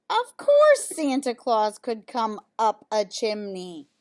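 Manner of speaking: ordinary delivery, nothing marked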